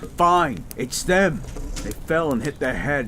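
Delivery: high, loud and insistent; urgent brush-off. Acted. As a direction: wave the medics off toward your partner. Urging rescuers to help partner.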